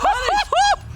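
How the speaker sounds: high screaming hype call; excited shout. Real, not acted. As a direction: scream it at the top of your range. Caster screams at a play.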